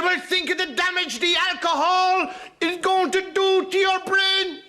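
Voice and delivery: high, slow prim nun warning; a shrill-free but very high stern nun scolding. Acted. Comic nun warning the little fellow.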